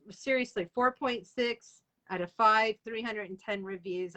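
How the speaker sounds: ordinary talk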